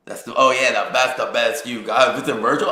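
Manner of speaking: cocky shouted retort; loud taunting comeback. Retold or imitated. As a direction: throw the taunt back loud and bold. Comeback to the boogeyman.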